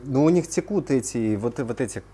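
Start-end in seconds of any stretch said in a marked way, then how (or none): none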